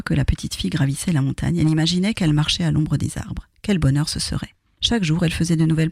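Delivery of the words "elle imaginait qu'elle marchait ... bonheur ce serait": usual speaking voice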